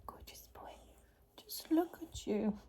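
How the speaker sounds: soft unvoiced whisper; whispered praise to dog. Real, not acted. Owner admiring her sleeping dog, tender whisper. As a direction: breathe the praise without voice.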